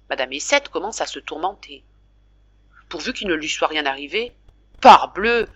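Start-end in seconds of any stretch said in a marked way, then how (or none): 4.8-5.5 loud, high and gruff. Acted. Father brushing off the worried mother, gruff loud tone. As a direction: grumble it off loudly. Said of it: "d'un ton bourru".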